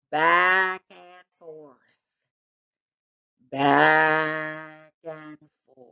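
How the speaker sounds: slowing right down, heavier each time; drowsy, slowing repetition; slow hypnotic repeat. A narrator's reading. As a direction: let the words sway like a pendulum. Hypnotized by the swinging thing.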